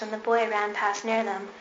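ordinary delivery

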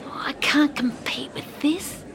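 put-on breathy whisper of a boy giving up; whispered thought. Boy sees the great plants, whispering in despair. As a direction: whisper it to yourself, beaten. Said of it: "he thought".

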